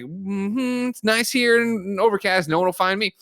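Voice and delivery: high, airy hum into singsong contentment; carefree Scarlett Johansson. Acted. Host playing the heroine alone in Norway, carefree.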